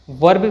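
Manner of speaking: वर्ब with an audible r; sounded r. Shown as a demonstration. Teacher showing the wrong way, r sounded. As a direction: pronounce the r in वर्ब. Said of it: "बोलना गलत है".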